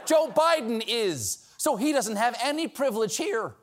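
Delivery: ordinary talk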